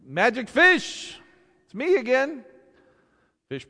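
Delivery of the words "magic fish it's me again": high, loud, drawn-out call; hollering. Acted. Fisherman calls the magic fish up, high, loud holler. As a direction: holler out across the water. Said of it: "hollers out to the fish".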